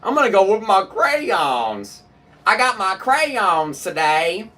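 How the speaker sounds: mock the extra syllable in "crayons", dragging it; mocking pronunciation. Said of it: "You are adding an extra syllable".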